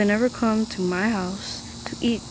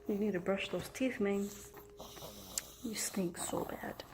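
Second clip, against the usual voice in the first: whisper; 14 dB quieter than usual. Real, not acted. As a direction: whisper it to the pet. Teasing his pet up close.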